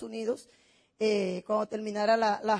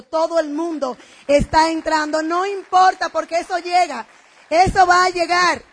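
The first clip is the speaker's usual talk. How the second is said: loud, raised-pitch motivational push; rousing conviction; 4 semitones higher, 8 dB louder than usual. Real, not acted. Speaker urging the audience that success will come, rousing and loud. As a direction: proclaim it to the whole room.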